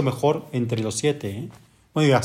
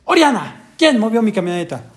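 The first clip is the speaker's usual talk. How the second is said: higher, louder mock yell at Oriana; retold yell; 7 semitones higher, 3 dB louder than usual. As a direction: replay the angry shout at the daughter. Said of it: "Le grité".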